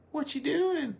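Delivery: high-pitched nosy woman's voice; woman asking. Retold or imitated. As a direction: squeak it out like a curious wife. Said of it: "a woman will say".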